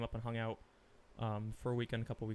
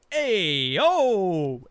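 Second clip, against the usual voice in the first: huge high re-enacted shout of triumph; very loud, drawn-out mock Italian whoop; exuberant holler; 8 semitones higher, 15 dB louder than usual. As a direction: bellow a celebratory Italian-American cheer. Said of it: "it wasn't even a joke".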